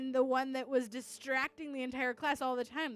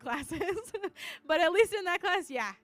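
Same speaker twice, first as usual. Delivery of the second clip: normal talking voice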